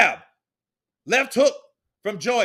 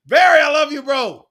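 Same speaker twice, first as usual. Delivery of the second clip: much louder, heartfelt cry out; loud emotional shout; 4 semitones higher, 11 dB louder than usual. Real, not acted. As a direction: yell it out full of feeling. Shouting love, crying.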